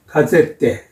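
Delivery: 가제테 starting with a hard g/k stop, ending in "te"; Polish pronunciation. Shown as a demonstration. Teacher compares the word for newspaper, Polish pronunciation of 가제테. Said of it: "the pronunciation is similar".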